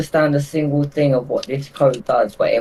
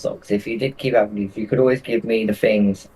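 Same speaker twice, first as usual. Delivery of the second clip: ordinary talk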